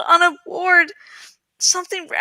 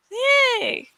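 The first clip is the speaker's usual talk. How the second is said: a high, bright little cheer; cheerful yay; 7 semitones higher than usual. Real, not acted. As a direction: celebrate finishing the chapter. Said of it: "cheers at end of reading".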